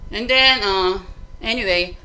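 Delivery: ordinary talk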